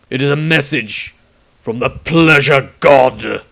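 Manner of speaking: loud, slow, spat-out disgust; great disgust. A narrator's reading. Witch hunter seeing the chaos mark, loud disgusted zeal. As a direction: spit out pleasure god with revulsion. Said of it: "uttered with a great disgust".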